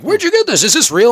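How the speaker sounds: high, loud astonished asking; gasping with disbelief; amazed. Retold or imitated. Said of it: "people are looking at it going".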